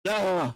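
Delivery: short groan of real frustration; pained ah; frustrated cry. Real, not acted. Frustration at missed trade.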